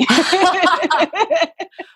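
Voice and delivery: a burst of high laughter. Real, not acted. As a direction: laugh it off, amused at yourself. Laughing at her own writing.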